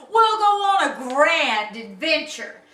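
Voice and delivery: loud, hearty captain; captain's announcement. Acted. Captain rallying his new crew, loud and hearty. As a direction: proclaim a grand plan.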